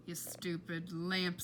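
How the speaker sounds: slow, low sneer; scornful muttering. Acted. Flora glaring at the lamp shepherdess, scornful. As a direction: spit the insult at the lamp.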